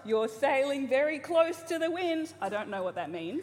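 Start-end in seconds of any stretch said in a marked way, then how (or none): 0.1-2.3 imitating dad. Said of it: "He really liked".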